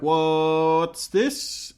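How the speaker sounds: long-held first word, rising sharply; bewildered. Retold or imitated. Pete reacting to Linda's kiss, drawn-out bewilderment.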